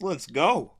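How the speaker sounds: excited cheer. Real, not acted. Streamer cheers at happy ending.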